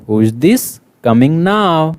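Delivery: loud, lively classroom read-aloud; teacher reading a picture story aloud to young pupils; reading to children. Acted. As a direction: project the story to the whole class.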